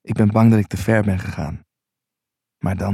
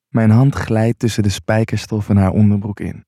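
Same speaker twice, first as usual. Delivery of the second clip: ordinary delivery, nothing marked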